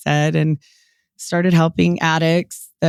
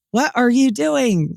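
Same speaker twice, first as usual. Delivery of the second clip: mom's alarmed question; 7 semitones higher than usual. Retold or imitated. Mom questions her research.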